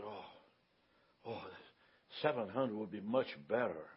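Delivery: very soft, low haggling reply; gentle quoted voice. Retold or imitated. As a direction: imitate a gentle man, hushed and hesitant. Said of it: "In a gentle voice".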